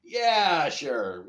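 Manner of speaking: loud, high drawn-out "yeah", dropping off; mock-agree at full volume; sarcastic. Mocking a trailer's true-events claim, sarcastic.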